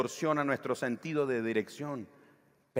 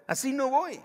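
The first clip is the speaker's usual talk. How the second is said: put-on higher pitched sulky child whining; childish complaint; 10 semitones higher, 3 dB louder than usual. Preacher imitating a sulking child, mock whine. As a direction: pout like a kid who quits the game. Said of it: "Como dicen los niños".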